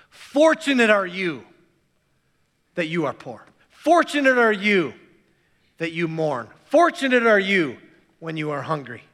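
Fortunate punched loud at each line start; stress on fortunate. Shown as a demonstration. Preacher reading his paraphrase with Fortunate punched. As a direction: lean hard on Fortunate every time. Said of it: "it extolled the good fortune".

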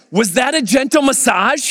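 mock-indignant husband, pitch up; irritated. Husband snapping at wife about the massage, irritated and high. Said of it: "He's irritated".

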